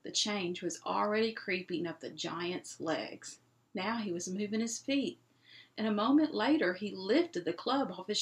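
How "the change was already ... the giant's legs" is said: usual speaking voice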